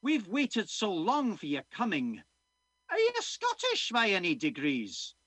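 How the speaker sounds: put-on hopeful old villager quizzing the newcomer; Scottish accent. Old man asks the doctor if he's Scottish, eager Scottish accent. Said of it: "I'm gonna be doing a Scottish accent".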